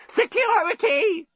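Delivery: very high, slow call; screaming robot. Acted. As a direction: shriek for security. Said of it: "screamed the desk bot".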